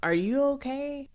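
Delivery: high, worried check-in; concerned ask. Retold or imitated. Matt asking nervous Toy if he's alright, concerned.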